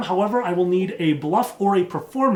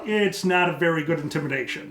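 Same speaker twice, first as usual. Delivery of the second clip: ordinary talk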